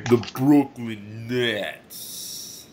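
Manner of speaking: very slow, hammed-up Brooklyn accent; badly faked Brooklyn accent with a cold. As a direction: overdo a Brooklyn accent, stretching every word. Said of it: "my best Brooklyn accent".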